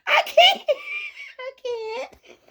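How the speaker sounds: squeaky laugh-choked words; pushing words out through giggles; laughing too hard. Retold or imitated. Mary refuses to help, choking on laughter. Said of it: "Mary said".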